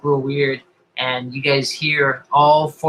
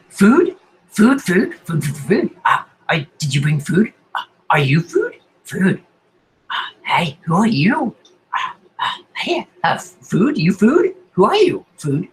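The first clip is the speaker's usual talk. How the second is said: insane voice; 5 semitones higher than usual. Acted. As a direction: repeat the words like a hungry half-mad thing. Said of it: "kind of insane, a little confused".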